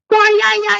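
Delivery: sudden happy shout; excited cheer. Real, not acted. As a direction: whoop it out in triumph.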